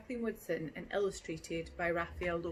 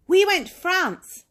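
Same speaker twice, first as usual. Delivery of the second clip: higher, louder, slower and proudly drawn out; proud kid; 11 semitones higher, 19 dB louder, slower than usual. Acted. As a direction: boast like a kid showing off her summer trip. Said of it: "Kayla says".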